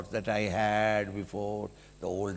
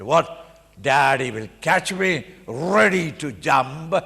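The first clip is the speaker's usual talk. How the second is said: put-on confident child; 4 semitones higher, 7 dB louder than usual.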